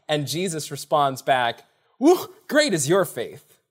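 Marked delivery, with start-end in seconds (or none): 2.0-3.6 excited praise. Retold or imitated. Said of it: "Jesus gets excited".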